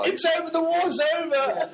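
high cheering shout of joy; jubilant shout. Pretend. Jubilant reader yelling in the street, high cheering shout. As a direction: yell it like the war just ended.

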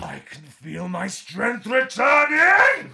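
very slow, high, swelling cry; reviving villain. Acted. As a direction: rise again like an anime hero. Sage revives theatrically.

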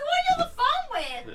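high-pitched indignant quote; mock demand. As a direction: fire the question in a high voice. Retold demanding question.

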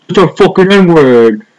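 loud, slow, high mock outrage; mock an indignant tough guy, drawn out and loud; exaggerated imitation of an angry rant; Chris Cuomo, pissed off. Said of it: "got all pissed off".

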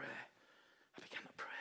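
slow whispered words; whispered prayer. Shown as a demonstration. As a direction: whisper it slowly.

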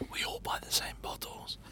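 hushed whisper. Real, not acted. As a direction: whisper it low. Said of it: "Why are we whispering?".

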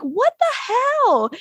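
mock-shocked squeal; shocked what the hell. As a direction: leap up in pitch in disbelief. Nadja shocked at stabbing.

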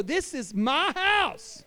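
slow, daring challenge at the line; defiant stand. Pretend. Father standing his ground against the enemy, slow and defiant. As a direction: dare them to cross it. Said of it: "you gotta stand up and go".

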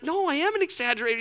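high-pitched, quick retold objection; mock protest. Preacher argues back to his mentor, high hammed-up protest. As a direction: plead your case in a high, hurried voice.

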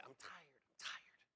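slow, breathy-soft weary moan; tired whisper. Retold or imitated. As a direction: drag it out, worn down. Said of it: "But I would always say".

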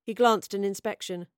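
plain reading voice

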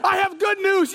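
high, shouted announcement; hammed-up angel heralding news; angelic proclamation. Angel announces to frightened shepherds.

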